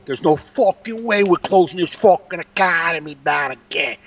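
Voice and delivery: put-on New York tough-talk accent; overdone accent. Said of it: "I definitely overdo".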